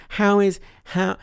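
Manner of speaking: put-on sneering send-up of a poor American accent; mocking a bad American accent. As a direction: parody her clumsy American accent. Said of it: "she's doing a shit American accent".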